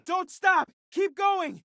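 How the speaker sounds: high-pitched urgent cry; urgent urging. Acted. Urging a companion not to stop, urgent high cry. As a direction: spur them on, pitch leaping up.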